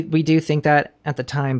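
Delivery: normal talking voice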